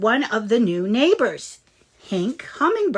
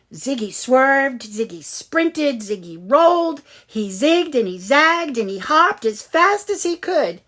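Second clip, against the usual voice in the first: excited chase narration; 5 semitones higher, 7 dB louder than usual. A narrator's reading.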